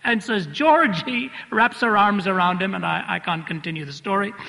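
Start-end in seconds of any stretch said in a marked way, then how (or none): 0.5-1.3 higher, rising gasp of the name; Mary's startled cry. Retold or imitated. Mary turning to find George, startled rising cry. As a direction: call his name in stunned joy.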